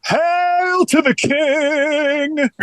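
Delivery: high, slow, stretched proclamation; grand hail. Acted. Co-host hails the host as king, grandly. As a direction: hail him like royalty.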